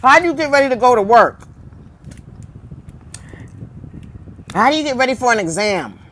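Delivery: talking while chewing food; mouth full. Real, not acted. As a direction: speak through a mouthful of food. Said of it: "Sorry, I'm chewing".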